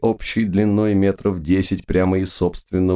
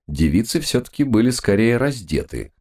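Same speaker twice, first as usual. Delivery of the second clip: plain reading voice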